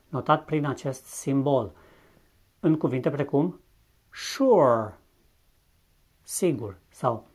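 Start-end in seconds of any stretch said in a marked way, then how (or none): 4.1-5.0 sure said with a SH sound, "shoor"; English sure. Shown as a demonstration. Teacher modelling English sure, SH sound. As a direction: say sure starting with SH. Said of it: "ca un ș românesc".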